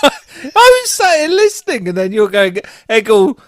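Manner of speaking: high, loud, laughing while mimicking; laughing mock. Real, not acted. Teasing co-host, mimicking her words while laughing. Mocking her Eggy Peggy attempt.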